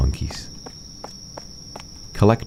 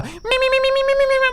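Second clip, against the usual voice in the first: very high silly me-me-me; high squeaky babble; much faster than usual. Pretend. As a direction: squeak like a cartoon.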